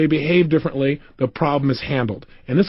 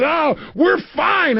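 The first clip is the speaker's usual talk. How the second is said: high, drawn-out mock cry; exasperated insistence; 11 semitones higher, much slower than usual. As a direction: imitate her insisting, stretched out slowly. Voices woman giving in, exasperated.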